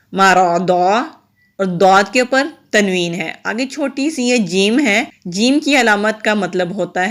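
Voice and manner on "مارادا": مارادا recited with a heavy, full-mouthed را and quick short vowels; recited tajweed demo. Shown as a demonstration. Teacher recites مارادا to show the heavy را. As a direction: sound out مارادا slowly in Quranic recitation. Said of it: "را کے اوپر زبر موٹا پڑھیں گے".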